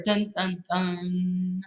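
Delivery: usual speaking voice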